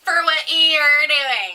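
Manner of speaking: loud, drawn-out mimicry of the scold; mocking echo. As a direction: parrot the line slowly and loudly. Listener echoes the scolding woman.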